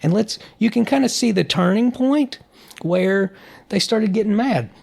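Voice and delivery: ordinary delivery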